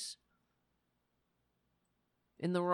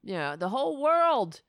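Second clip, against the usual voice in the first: ordinary talk, nothing marked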